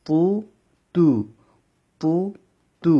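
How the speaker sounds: "পু" thick and full, "তু" thin; heavy and light with pesh. Shown as a demonstration. Teacher drilling "পু" against "তু" for students. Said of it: "পু মোটা হবে".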